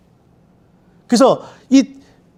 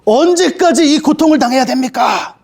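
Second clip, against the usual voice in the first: higher, anguished plea to God; martyrs crying out; 6 semitones higher, slower than usual. Acted. Martyrs pleading with God for justice, high and anguished. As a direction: cry out like the slain saints asking how long. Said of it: "하나님 앞에 이렇게 얘기하는 거예요".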